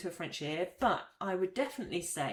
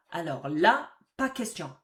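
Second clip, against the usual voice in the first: emphatic, louder no way; firm refusal; 7 dB louder than usual. Shown as a demonstration. Teacher showing a flat refusal, firmly. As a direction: shut the idea down firmly. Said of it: "Don't even go there".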